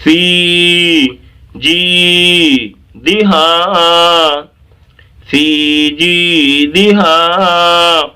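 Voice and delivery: slow, sustained chanting of فی جی دہا; drawn-out recitation. Shown as a demonstration. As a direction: hold each long vowel in فی جی دہا. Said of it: "اس طرح سے".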